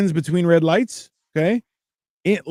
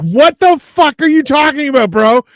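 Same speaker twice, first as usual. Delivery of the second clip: high, loud disbelieving shout; incredulous yell; 9 semitones higher, 9 dB louder than usual. Retold or imitated. Crowd yells at the calm handler, loud disbelief. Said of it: "And you're like".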